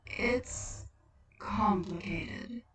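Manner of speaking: slow, hushed and hesitant; a weary otherworldly figure choosing words; slow whispery Death; Death's voice. Acted.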